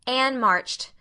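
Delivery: ordinary delivery, nothing marked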